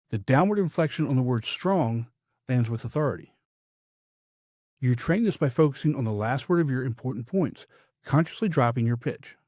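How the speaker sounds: ordinary talk, nothing marked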